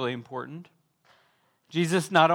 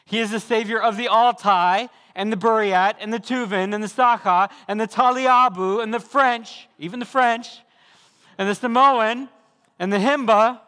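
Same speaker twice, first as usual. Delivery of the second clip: rising, louder litany of peoples; preaching at full force; 5 semitones higher, 5 dB louder than usual. Acted. Preacher naming every people group to the congregation, full-force preaching. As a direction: build each name higher and louder like a preacher.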